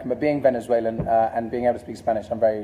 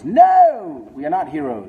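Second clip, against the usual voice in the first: mock-outraged, raised-pitch cry; emphatic denial; 3 semitones higher, 4 dB louder, much slower than usual. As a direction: shout down the very idea. Emphatic joking denial of question.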